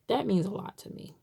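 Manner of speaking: ordinary delivery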